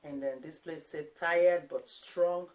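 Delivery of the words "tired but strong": slow and louder, each word weighted; "Tired but strong" said very slowly and loudly; emphatic reading. Shown as a demonstration. Mom reading out the family motto, slow and emphatic.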